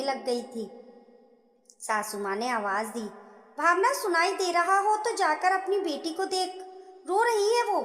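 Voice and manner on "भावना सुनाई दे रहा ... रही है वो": raised mother-in-law voice, higher; mother-in-law yelling at bahu to tend the baby, raised; calling out. Acted. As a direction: call to her from another room. Mother-in-law calls across the house.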